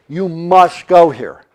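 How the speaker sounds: ordinary talk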